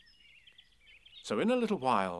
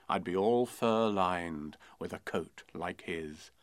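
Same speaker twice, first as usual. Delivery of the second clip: put-on steady, rhythmic children's rhyme; poem recital; 4 dB louder than usual. A narrator's reading. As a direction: read it like a bedtime verse.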